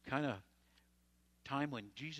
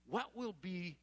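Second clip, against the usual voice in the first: mock Peter; 8 semitones higher than usual. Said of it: "Peter asked Jesus".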